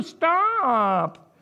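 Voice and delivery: drawn-out high exclamation; exasperated cry. Real, not acted. Preacher urging people to quit pretending, exasperated drawn-out cry.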